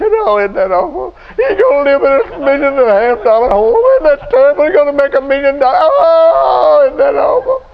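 high, loud mocking whine, hammed up; preacher mocking a wife who frets her husband's raise is awful, in a high, loud whine; mock complaining wife. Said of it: "just start going into his text".

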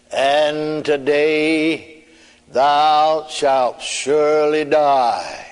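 heavenly voice. Retold or imitated. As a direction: intone it slowly like a voice from heaven. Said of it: "And then the voice said".

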